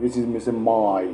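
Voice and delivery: مای sounded as a gliding English diphthong; clearly glided مای; diphthong demo. Shown as a demonstration. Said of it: "زبان خودتون رو ... تغییر بدید".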